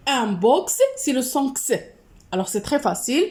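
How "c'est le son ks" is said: crisp "ks" sound for the letter X. Shown as a demonstration. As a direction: say X as an unvoiced "ks". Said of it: "selon la prononciation".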